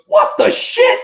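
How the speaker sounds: very high, loud, laughing disbelief; mock shocked outburst. Fan mimics Deadpool reacting to the cameo, laughing mock shock.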